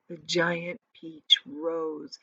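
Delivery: plain reading voice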